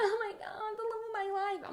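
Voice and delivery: high, tearful imitation, softly done; hammed-up weepy squeal, kept soft; mock crying. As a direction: pretend to weep in a high voice. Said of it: "she's, like, crying over".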